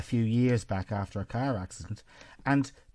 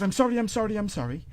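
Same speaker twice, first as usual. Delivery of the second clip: imitating a flustered man's hurried apology; high, pleading mock apology that drops off; panicked apology; 8 semitones higher, 3 dB louder, faster than usual. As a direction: act out a caught man apologising. Acted panicked apology in retold row.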